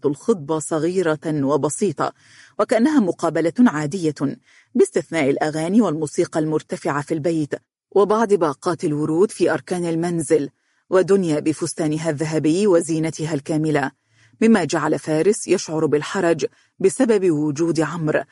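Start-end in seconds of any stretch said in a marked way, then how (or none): none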